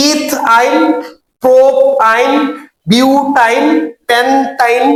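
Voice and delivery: stem and suffix split, as in "ইথ ইন"; syllable-by-syllable drill. Shown as a demonstration. Teacher drills students on naming, "ইথ আইন" in two separated beats. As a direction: chant each name in two beats, like "প্রোপ আইন". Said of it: "আমি বলতে পারবো কি বলতো?".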